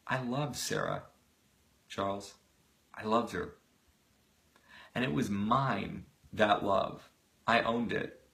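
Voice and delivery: a hushed, heartfelt brother's reply; tender quiet conviction. Acted. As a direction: say it gently to a brother who finally understands. Donald's tender reply to his brother.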